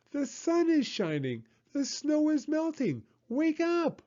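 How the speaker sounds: high, bright frog announcing spring; bursting with spring news; excited high voice. Acted. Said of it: "cried Frog".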